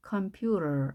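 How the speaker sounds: usual speaking voice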